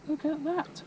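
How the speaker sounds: high, rising look at that; excited. Real, not acted. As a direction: show off the catch with delight. Admiring the landed fish.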